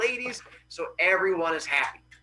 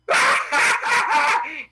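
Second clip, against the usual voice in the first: loud, high acted cackle; mocking laughter; 11 semitones higher, 6 dB louder than usual. Other kids laughing at the boy's claim, loud mocking laugh. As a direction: burst out in jeering fake laughter. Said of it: "the other kids go".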